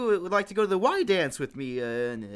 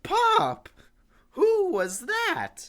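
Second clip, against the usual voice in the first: high loud startled yell; shocked outcry; 12 semitones higher, 4 dB louder, much slower than usual. Real, not acted. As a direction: cry out in disbelief. Son shocked, demands answer.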